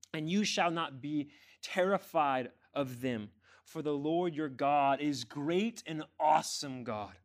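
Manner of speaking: slow, loud, emphatic proclamation; forceful scripture reading. Acted. Preacher reading Deuteronomy to the church, forceful and slow. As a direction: proclaim the verse with weight.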